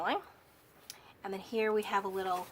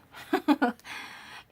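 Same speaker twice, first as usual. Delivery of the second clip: short, higher laugh of her own; 6 semitones higher, 3 dB louder than usual. Real, not acted. Crafter fumbling the kit's name, laughing. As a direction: laugh it off after the slip.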